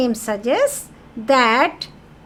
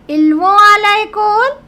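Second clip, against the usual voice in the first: whole line higher and louder, "l'école" rising; rising question tune; 9 semitones higher, 9 dB louder than usual. Shown as a demonstration. Teacher showing students how to raise the voice. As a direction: pitch it up and loud like a question. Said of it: "Raise your voice now".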